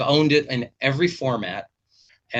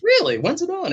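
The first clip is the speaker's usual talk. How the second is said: much higher, loud surprised question; 4 dB louder than usual. Retold or imitated. Professor hears his student hosts a show, high surprised. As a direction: squeak it out, caught off guard.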